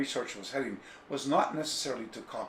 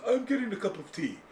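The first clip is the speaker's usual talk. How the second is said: higher put-on voice of the bookseller; imitating Takeshi; 10 semitones higher than usual. Teller imitates Takeshi leaving for tea, higher put-on voice. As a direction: act out the old shop owner stepping away. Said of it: "I always love the way Taki, he goes".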